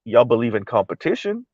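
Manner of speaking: low, soft question rising at the end; skeptical echo. Real, not acted. Commentator answering the elite's claim, skeptical. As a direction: echo it back quietly, lifting into doubt.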